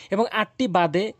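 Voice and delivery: normal talking voice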